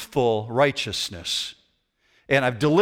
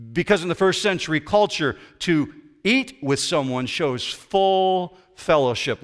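normal talking voice

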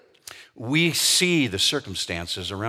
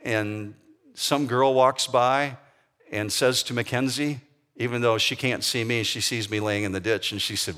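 ordinary delivery

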